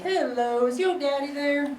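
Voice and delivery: high put-on voice of a woman caller; imitated neighbor. Neighbor phoning to ask for his dad, high put-on voice. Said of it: "then she's like".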